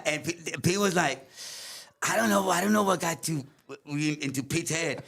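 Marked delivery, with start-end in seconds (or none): none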